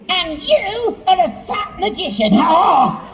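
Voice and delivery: high, strange frog voice. Acted. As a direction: play the rude frog, pitched high. Frog insults magician.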